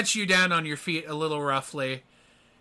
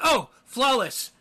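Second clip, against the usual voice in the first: high, slow, louder recognition; surprised recognition; 10 semitones higher, 5 dB louder, slower than usual. Acted. Armed cops spot the famous heroine, surprised recognition. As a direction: realize who is standing there.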